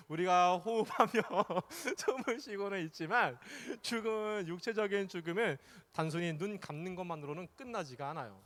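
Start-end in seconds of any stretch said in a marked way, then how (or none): 0.1-3.4 talking through a soft laugh; chuckling. Real, not acted. Preacher amused by children feeling sleepy, chuckling through the words. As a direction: try to carry on while giggling.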